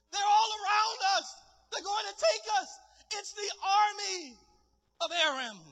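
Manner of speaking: high-pitched cry of alarm; panicked servant. Acted. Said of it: "the servant is in panic".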